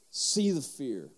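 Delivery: "See the fear" drawn out very slowly; like a mystic coach guiding his son, very slow; slow dramatic coaching. Shown as a demonstration. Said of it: "total nerd psychology move".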